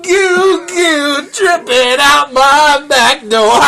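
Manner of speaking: high, slow, crying-laughing wail; hammed-up wail. Joking about the hotline with a friend, high slow mock wail. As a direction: stretch every word out in mock anguish.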